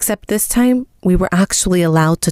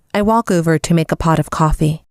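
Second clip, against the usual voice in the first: usual speaking voice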